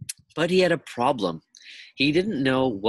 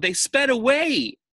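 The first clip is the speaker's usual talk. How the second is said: much higher and louder, a tearful wail; 13 semitones higher, 6 dB louder than usual. Acted. Dana tells Myron about the car, wailing higher. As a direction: let it break into a wail. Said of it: "Dana sobbed".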